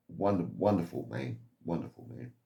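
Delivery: a quiet, heartfelt tribute; tender praise. Real, not acted. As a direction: let it fade softly with affection. Fond memory of a kind neighbour.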